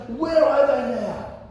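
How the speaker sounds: high, slow, aching question to Israel; pent-up frustrated cry. Retold or imitated. Gideon asking Israel where the wonders are, frustrated and high. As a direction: let the frustration lift it up high. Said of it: "he says to Israel".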